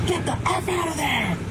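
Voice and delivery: high, drawn-out retold yell; imitated loud yell. Witness retelling what was yelled at the drive-thru, imitated yell. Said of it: "Like, so loud".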